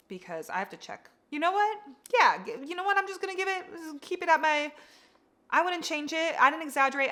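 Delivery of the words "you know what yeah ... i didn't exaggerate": higher, decisive, half-laughing; lively, higher-pitched resolve; higher excited voice. Real, not acted. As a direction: pitch up as she makes up her mind. Deciding to keep the rating.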